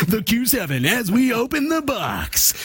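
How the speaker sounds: high, hyped-up TV pitchman; infomercial voice. Pretend. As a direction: sell it like a late-night ad. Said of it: "there's the infomercial voice".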